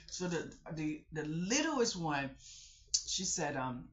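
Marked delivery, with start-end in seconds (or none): none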